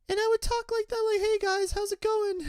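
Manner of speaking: very high, small squeaky voice; high-pitched young voice. Retold or imitated. Younger self greeting players on voice chat, high-pitched. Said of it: "have, like, high-pitched voices".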